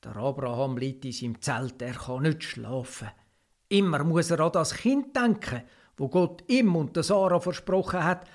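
plain reading voice

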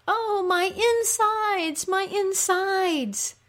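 very high, long-drawn wail; moaning cry. Acted. Ereshkigal moaning like a woman in labor, moaning cry. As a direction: moan slowly and high, in agony. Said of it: "Ereshkigal cries out".

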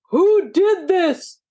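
slow high holler; angry holler. A narrator's reading. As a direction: holler it slowly. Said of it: "he hollered".

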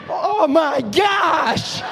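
slow, loud, laughing "oh my gosh"; exasperated shout. Retold or imitated. Pastor reacting to a backhanded compliment, slow laughing shout. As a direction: throw your head back in mock despair.

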